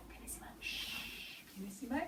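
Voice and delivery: barely audible whisper; pianissimo hush. Shown as a demonstration. Teacher showing children pianissimo, in a tiny hush. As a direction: hush it right down, as tiny as possible. Demonstrating the very quiet dynamic.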